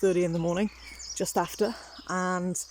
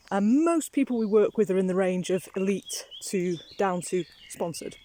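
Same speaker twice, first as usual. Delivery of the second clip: ordinary talk, nothing marked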